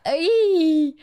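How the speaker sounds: high, drawn-out excited squeal; delighted squeal. Real, not acted. Gushing over a new tattoo, high delighted squeal. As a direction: squeal over something adorable.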